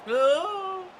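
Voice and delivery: loud, very high "oh!"; high-pitched held exclamation; high startled oh. Real, not acted. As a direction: cry out high at a near chance. Reacting to a chance.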